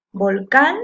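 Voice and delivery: volcán with CÁN punched loud; stressed last syllable. Shown as a demonstration. Teacher proving the word is aguda, volcán with CÁN stressed. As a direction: hit the final cán of Volcán hard. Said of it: "suena la última sílaba con más fuerza".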